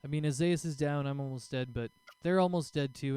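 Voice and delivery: ordinary delivery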